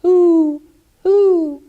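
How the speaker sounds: high, loud hoot; boy mimicking the owl's call; imitating an owl. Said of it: "heard a bird go".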